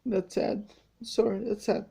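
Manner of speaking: soft, sad apology; quietly overcome, fighting tears; real tearful. Real, not acted. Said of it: "I'm crying right now".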